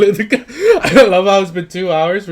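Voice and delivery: high, loud laughing disbelief. Real, not acted. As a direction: laugh through the words. Laughing at long character creation.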